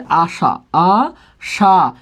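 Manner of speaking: আশা stretched very slowly, sound by sound; drawn-out demonstration. Shown as a demonstration. Teacher shows students the word's final sound, আশা slowly drawn out. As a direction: draw out each vowel of আশা.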